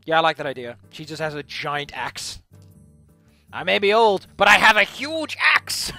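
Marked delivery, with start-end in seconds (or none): 3.5-5.9 put-on sassy old lady. Character boasts in old voice.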